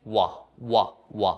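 ওয়া repeated as a short, separate "wa"; ওয়া as an isolated "wa" syllable; W sound demo. Shown as a demonstration. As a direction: sound out ওয়া three times, a pause between each. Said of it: "এরকম উচ্চারণ হচ্ছে".

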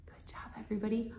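soft unvoiced whisper. Teacher whispering to settle the children. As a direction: settle them down quietly. Calming the class down.

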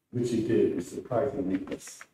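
ordinary delivery

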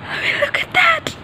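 real high, breathless excitement; holding back screams. Real, not acted. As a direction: squeal it under your breath, barely containing yourself. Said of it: "I'm literally trying not to scream".

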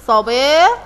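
"صباح" with a drawn-out middle and an -in ending; teacher sounding out the kasr tanween on "صباح"; tanween demonstration. Shown as a demonstration. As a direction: say "صباح" as sabaa-hin, stretched and rising. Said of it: "هستخرج تنوينا أو كلمة بها تنوين".

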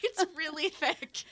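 high, slow, laughing defence; laughing, drawn out and high; laughing protest. Real, not acted. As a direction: protest through giggles, pitched right up. Laughing about unfinished long book.

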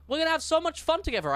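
bright, fast and high; cheerful assistant voice. Pretend. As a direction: sell it like a perky mascot. Line repeated later.

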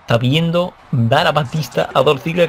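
normal talking voice

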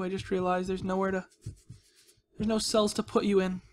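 ordinary talk, nothing marked

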